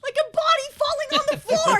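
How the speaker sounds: loud, high mock yell; mock shout. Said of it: "What does it sound like?".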